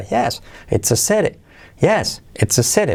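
high YES, CITY lifted to the same point; affirmative intonation. Shown as a demonstration. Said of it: "ponemos yes en nuestra cabeza". ("yes" alto y "city" a la misma altura)

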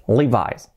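Levi's as "LEE-vise"; English "li-VAIS" for Levi's; English pronunciation. Shown as a demonstration. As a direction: say Levi's with a final "eye" sound. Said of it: "Así, fijaos".

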